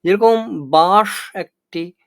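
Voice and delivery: বাঁশ said slow and loud as one closed syllable; stress on বাঁশ. Shown as a demonstration. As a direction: lean on the word বাঁশ. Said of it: "রুদ্ধ দল". (বাঁশ শব্দে জোর দিয়ে ধীরে)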